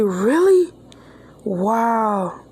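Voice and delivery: lightly acted surprise at the news; surprised. Leo hears they all got new Pokemon, a touch surprised.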